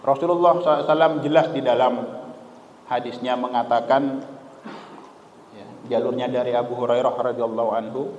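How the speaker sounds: ordinary talk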